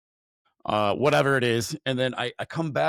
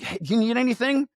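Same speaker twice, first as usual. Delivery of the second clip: much higher, friendly offer of help; eager offer; 10 semitones higher than usual. Retold or imitated. Asking the man in the car if he needs anything, eager. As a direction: lift it up, keen to help.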